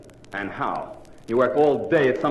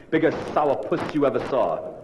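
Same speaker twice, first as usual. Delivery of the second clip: ordinary talk, nothing marked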